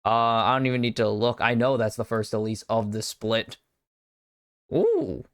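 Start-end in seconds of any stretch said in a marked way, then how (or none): 4.7-5.2 ooh of surprise. Real, not acted. Reacting to draft.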